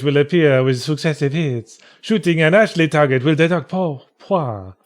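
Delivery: a light put-on Chinese accent reading rules. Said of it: "turning into a racist Chinese accent".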